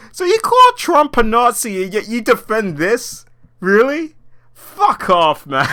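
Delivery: mocking scornful challenge; scornful retort. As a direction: call out their hypocrisy with scorn. Retorting to celebrities.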